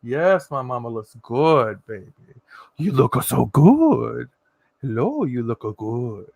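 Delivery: slow, hammed-up admiring drawl; imagined hubby voice. Hubby complimenting his glowing wife, slow hammed-up drawl. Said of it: "that's how he sounds".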